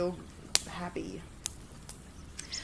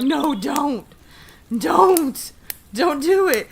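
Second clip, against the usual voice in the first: louder, higher pleading warning; retold urgent pleading; 5 semitones higher, 7 dB louder, much slower than usual. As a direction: beg her firmly to stop, word by word. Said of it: "and I'm like".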